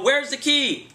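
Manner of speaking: loud, high yelled question; shouting question. Retold or imitated. Bounty hunter asking trapped fugitive, loud shout. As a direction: call it through the trunk.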